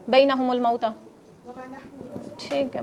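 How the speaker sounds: ordinary talk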